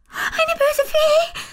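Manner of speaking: put-on high, slow, startled shriek of a little girl; frightened cry. A narrator's reading. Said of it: "ruft erschrocken". (erschrockener Aufschrei, hoch und langsam)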